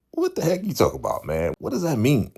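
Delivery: high, quick mock exasperation; acting out baffled disbelief, pitch way up; incredulous challenge. Keith challenging the unsure new player, incredulous.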